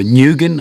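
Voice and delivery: Nguyen said as NEW-gun, English style; mispronunciation. Shown as a demonstration. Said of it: "all three of those are, are way off".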